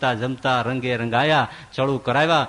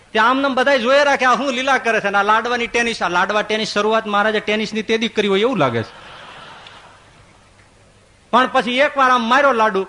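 delighted telling; 6 semitones higher, 4 dB louder than usual. A narrator's reading. Preacher enjoys the laddu game.

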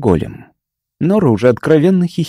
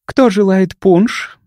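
sweet slow purr, higher pitch; breathy vintage purr; 7 semitones higher, slower than usual. Acted. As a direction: purr the offer sweetly and slowly. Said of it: "мило промурлыкала Нора".

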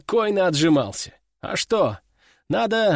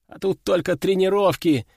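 plain reading voice

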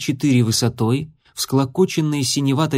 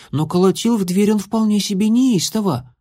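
lightly voiced young girl; higher girl's voice; 8 semitones higher than usual. A narrator's reading. Issy character voice.

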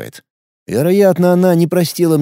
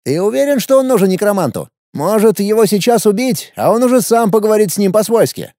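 put-on higher, boyish young emperor; teenage boy voice; 8 semitones higher than usual. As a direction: play an arrogant teenage ruler. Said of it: "спросил император". (голос подростка-императора)

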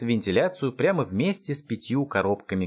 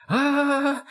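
long, very high howl; high sustained wail of agony; howl of pain. A narrator's reading. Enemy hit by hot pizza, howling. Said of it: "взвыл тот".

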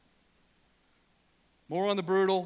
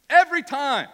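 higher, louder burst; loud exclamation; 6 semitones higher, 10 dB louder than usual. Real, not acted. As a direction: nail it down with a shout. Preacher driving the point home.

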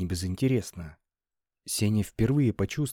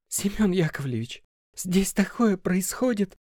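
wailing; 10 semitones higher, 3 dB louder than usual. A narrator's reading. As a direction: wail it, near tears. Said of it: "запричитала Лена".

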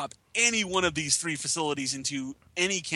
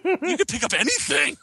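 laughing; 11 semitones higher, 5 dB louder than usual. Real, not acted. Laughing joke about the station.

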